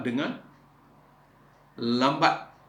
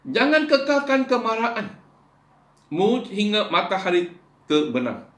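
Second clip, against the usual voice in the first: preaching at full force; 10 semitones higher, 7 dB louder than usual. Acted. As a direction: thunder the verse out to the congregation. Preaching the verse.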